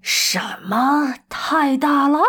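slow, drawn-out disbelieving question; incredulous. A narrator's reading. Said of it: "经理不可思议地盯着他说". (难以置信地拖长声音反问)

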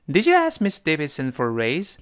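clear, careful read of "Did you ask Miss Davidson for a raise?"; model English reading. Shown as a demonstration. Teacher reads the dialogue line aloud for students to copy, carefully. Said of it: "那第一个人先问".

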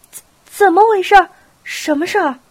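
stammered high, loud alarm; hurried, startled question. A narrator's reading. Princesses seeing the angry old man, startled urgent questions. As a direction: burst out asking, stumbling on the first word. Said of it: "连忙问道".

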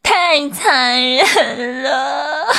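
slow, drawn-out mock wail; hammed-up crying. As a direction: stretch each word into a theatrical sob. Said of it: "不哭了啊".

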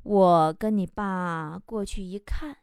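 slow, soft, low musing; imitating an unhurried mother, trailing quietly; mom's leisurely drawl. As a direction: take your time, with idle pauses. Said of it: "一边悠悠地说".

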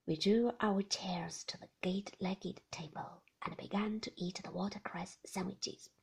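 plain reading voice

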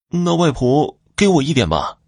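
put-on high-pitched little boy's request; lightly voiced child. Youngest son asking grandmother for some, lightly voiced child.

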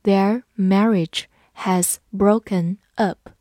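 slow, careful read-along. Shown as a demonstration. Teacher reads slowly so students repeat. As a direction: say each word slowly for the class. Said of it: "跟着我慢读一遍".

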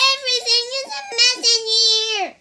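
high, loud, fast squeaky wail; child voicing a frantic little worm; worm crying out. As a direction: rattle it off as a tiny worm in chaos. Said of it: "cried the worm".

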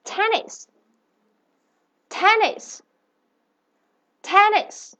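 "Tennis" said slowly with a high clear pitch; "tennis" sounded out for the class to repeat; model pronunciation. Shown as a demonstration. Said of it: "我们先来跟[name]念一下这个单词哈".

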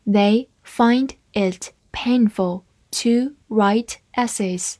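slow, careful read-aloud. Shown as a demonstration. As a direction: read the sentence slowly for learners. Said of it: "好，慢读一遍". (慢速朗读)

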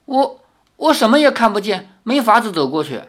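high, hesitant protest; flustered helplessness. A narrator's reading. Li answering the girl from pitch darkness, flustered and helpless.

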